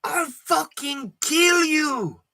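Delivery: put-on slow, high accented threat; mom's threat. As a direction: drag it out like a shrieking mother. Mom threatens the speaker.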